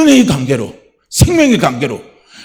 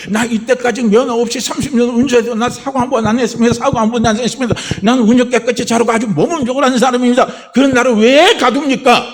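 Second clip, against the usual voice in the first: high-pitched, insistent self-defence; indignant protest; 6 semitones higher than usual. Acted. Unlicensed driver pleading his record in court, indignant protest. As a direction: argue your case in a raised pitch. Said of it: "자기가 항의를 하는 걸".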